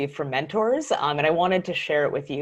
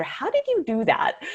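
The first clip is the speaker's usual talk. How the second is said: amazed question; 12 semitones higher than usual. Retold or imitated. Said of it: "And I asked her after".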